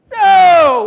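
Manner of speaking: high, loud cry of no. Acted. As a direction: shout down the idea. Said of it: "answers own question loudly".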